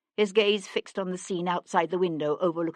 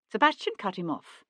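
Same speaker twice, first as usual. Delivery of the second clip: ordinary delivery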